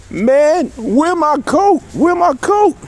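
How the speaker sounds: high, slow, exasperated "where my coat"; indignant demand. Retold or imitated. Inmate asking the guard about his missing coat: high, exasperated. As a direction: push the pitch up in disbelief.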